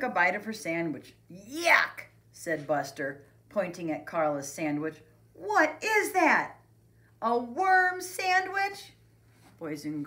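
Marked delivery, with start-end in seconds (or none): 1.3-2.1 loud, higher cry of disgust. A narrator's reading. Buster disgusted by sandwich. 5.3-8.9 high, loud, slow incredulous question; disgusted mocking. A narrator's reading. Buster mocks Carla's sandwich.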